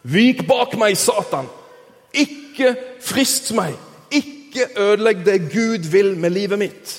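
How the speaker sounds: loud, high acted rebuke; Jesus irettesetter skarpt. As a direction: drive the tempter back forcefully. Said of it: "Sterke ord". (høy, streng og spilt irettesettelse)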